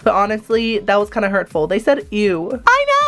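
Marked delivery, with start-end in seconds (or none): 2.7-3.0 high, loud exclamation; indignant outburst. Acted. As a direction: burst out at a much higher pitch. Anna exclaims over the insult.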